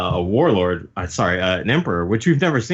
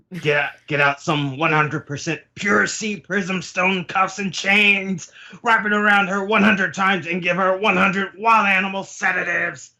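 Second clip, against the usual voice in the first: high-pitched, hammed-up urgent command; panicked orders; 11 semitones higher than usual. Queen demands chains and sedatives for Big Mom, high panicked command. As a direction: drive the order higher as panic builds. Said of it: "he panics and goes".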